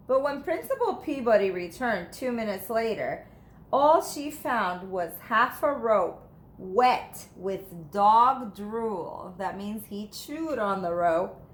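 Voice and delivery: ordinary delivery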